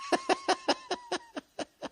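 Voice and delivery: real high squealing laugh. Real, not acted. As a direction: burst into a high-pitched giggle. Laughs at his own bit.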